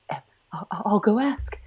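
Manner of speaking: a hushed, sheepish offer; timid mock whisper. Roger meekly offers to ask the librarian, whispering. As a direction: whisper it like a cowed husband. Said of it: "poor Roger's like".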